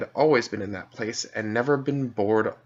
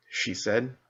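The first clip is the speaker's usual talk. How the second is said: usual speaking voice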